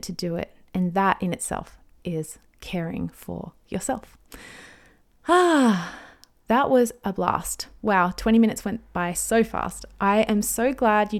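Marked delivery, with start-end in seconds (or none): 5.3-6.0 loud, high sighed "ah"; big sigh. Real, not acted. Podcaster finishing twenty minutes of talk, sighing with relief. Sighs as the episode wraps up.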